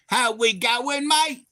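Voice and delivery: very high, drawn-out Aussie twang; hammed-up Australian greeting, stretched and squealed; mock the Aussie greeting in a slow, high voice; mock Aussie accent. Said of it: "And I was like".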